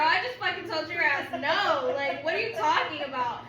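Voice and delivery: laughing mock outrage. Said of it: "And I'm like".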